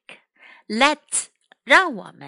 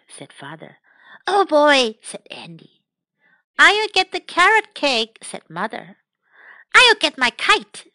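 lively storytime read-aloud with raised character lines; children's story narration; 3 semitones higher than usual. Acted. Rereads the story in English.

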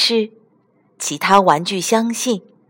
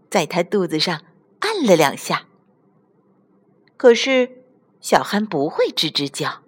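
usual speaking voice

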